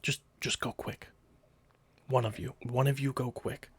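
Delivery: furtive guard. Acted. Guard hurrying one person off on the quiet, hushed. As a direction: quietly wave them on, dropping almost to a whisper. Game master plays the guard.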